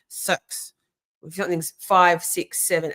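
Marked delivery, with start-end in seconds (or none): 0.1-0.7 "Six" as "sux", imitated New Zealand vowel; New Zealand accent. Mocking how little New Zealanders open their mouth, New Zealand accent. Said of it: "They really don't open their mouth".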